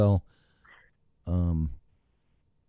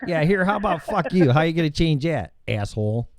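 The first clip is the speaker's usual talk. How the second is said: mock cursing at phone; 7 semitones higher than usual.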